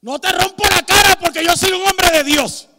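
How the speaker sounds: high, very loud angry shout; hammed-up furious yell at an offender; carnal threat. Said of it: "lo que cualquier cristiano carnal le diría". (grito de amenaza furioso y exagerado)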